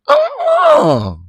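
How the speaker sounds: very high, loud uh-uh; very high, loud denial; squealed no. Real, not acted. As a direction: refuse it with a shriek. High refusal in disbelief.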